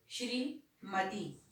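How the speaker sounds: slow श्रीमती with clear shri cluster; teacher modelling श्रीमती slowly; correct pronunciation. Shown as a demonstration. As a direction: sound out श्रीमती carefully, shri not shi. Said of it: "इसका शुद्ध उच्चारण होगा".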